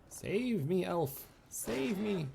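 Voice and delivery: high, hammed-up cry for rescue; mock plea for help. Player begs the elf to save him from a wolf, mock panic. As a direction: squeal for help like a damsel in distress, tongue in cheek.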